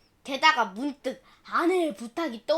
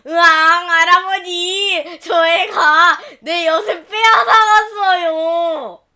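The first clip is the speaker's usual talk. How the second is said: loud, slow tearful howl; boy sobbing to his grandfather; child wailing; 3 semitones higher, 10 dB louder, slower than usual. Acted. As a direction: wail to grandpa for help.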